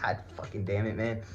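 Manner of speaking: low, quiet exasperated curse; retold exasperation. Patient dreading the male surgeon's exam, quietly exasperated. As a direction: curse under your breath in mild dismay.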